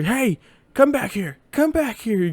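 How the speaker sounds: high mock-angry teacher calling out; mad teacher. Teacher calls back the kid leaving school, mock angry yell. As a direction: imitate a teacher yelling after a kid. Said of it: "she was mad that he made that".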